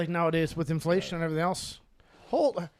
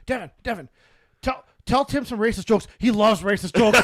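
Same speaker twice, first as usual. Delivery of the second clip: eager quote; 3 semitones higher, 7 dB louder than usual. Retold or imitated. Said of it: "Kyle's like".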